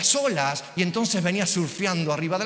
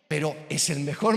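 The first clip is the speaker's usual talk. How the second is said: normal talking voice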